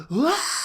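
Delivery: high wail of a crowd in tears; hammed-up tearful "ah"; mock crying wail. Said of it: "Everyone's crying".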